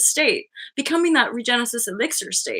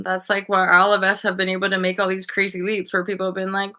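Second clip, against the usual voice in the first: normal talking voice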